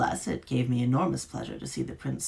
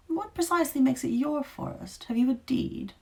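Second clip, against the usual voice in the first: higher, arch and superior; haughty creature voice; 12 semitones higher than usual. A narrator's reading. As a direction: question her with lofty disdain. Creature questions witch haughtily.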